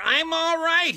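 high, loud yell; reassuring yell. A narrator's reading. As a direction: shout it again to reassure. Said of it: "Briggs yelled to reassure her".

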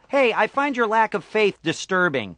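high-pitched villain lines with no menace; deliberately weak take on a menacing villain; unconvincing Darth Vader. Shown as a demonstration. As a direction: menace lost in an everyday voice. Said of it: "if I say Darth Vader's lines, it doesn't have the same".

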